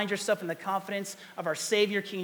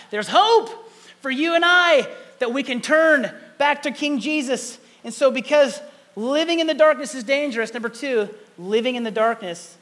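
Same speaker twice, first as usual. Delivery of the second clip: impassioned preaching; 7 semitones higher, 10 dB louder than usual. Acted. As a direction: lift the room with hope. Preaching climax on hope.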